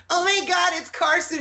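a fan's high-pitched, starry-eyed shriek; high squeal of surprise. Retold or imitated. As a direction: squeal it like a starstruck fan. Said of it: "the inflection in his voice when she goes".

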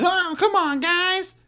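high, whining complaint; whiny Phillip Rivers. Retold or imitated. Phillip Rivers whining at his linemen, high whiny voice. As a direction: whine it up high like a sulking quarterback. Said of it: "he's always".

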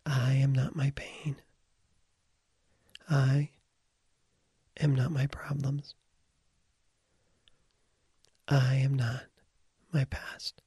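whispering in your ear. Shown as a demonstration. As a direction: whisper each line into their ear. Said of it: "I'm gonna begin to whisper in your ear".

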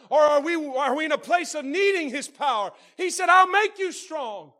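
loud, high, fervent preaching; shouting preacher. Acted. Preacher rousing the church, loud and high. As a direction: lift the whole room with a shout.

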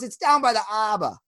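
cartoon Boston accent, H and R dropped; imitated Boston accent. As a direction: mimic a thick Boston cab driver.

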